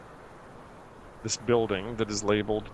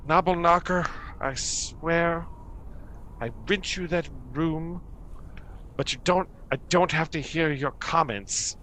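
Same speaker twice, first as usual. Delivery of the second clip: higher, exasperated scolding upward; annoyed priestess; 6 semitones higher than usual. Acted. Priestess scolding the gnome upstairs, higher and exasperated. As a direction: scold the lodger overhead, fed up. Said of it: "she looks up and she says".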